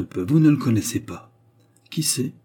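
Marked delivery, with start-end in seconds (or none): 1.9-2.3 sarcastic. A narrator's reading. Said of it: "du même ton sarcastique".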